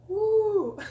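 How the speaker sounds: high, loud whoop breaking into laughter; whoop then laugh. Real, not acted. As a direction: cheer yourself on. Cheering after her own quote.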